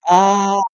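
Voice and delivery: high loud gasping ah after a fiery gulp. Acted. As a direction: gasp as the liquor burns. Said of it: "gasped the smallest fox".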